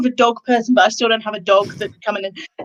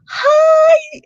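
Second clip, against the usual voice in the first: very high, loud, drawn-out hello; big group greeting; 6 dB louder than usual. Retold or imitated. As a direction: imitate adults gushing at a toddler. Group greeting a colleague's small child.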